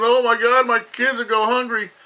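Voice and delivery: high, loud mock panic of complaining parents; mocking panicked quote. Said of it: "When people say".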